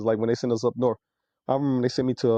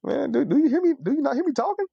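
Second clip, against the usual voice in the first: incredulous disbelief. Retold or imitated. Mississippi guy telling northerners they're the ones hearing an accent, high incredulous outrage. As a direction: retell it like you can't believe they said that. Retold retort to an accent remark.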